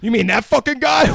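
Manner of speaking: high, loud mocking shout; hammed-up taunt. Hobo jeering at dead Santa, loud. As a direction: jeer it out.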